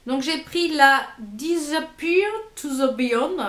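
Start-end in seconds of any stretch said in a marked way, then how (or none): 1.2-3.4 slow, careful English with a French accent; accent anglais approximatif. Real, not acted. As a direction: sound out the English name slowly, thick French accent. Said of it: "toujours mon accent super anglais". (anglais lent à l'accent français)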